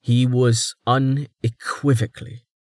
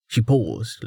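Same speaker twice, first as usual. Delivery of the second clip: usual speaking voice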